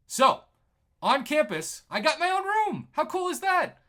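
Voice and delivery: real thrill at finally having his own room; excited. Real, not acted. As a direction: burst out with delight at the win. Triumph at finally getting own room.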